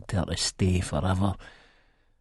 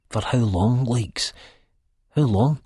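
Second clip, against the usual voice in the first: anxious plea; 5 semitones higher, slower than usual. A narrator's reading. Pleading question.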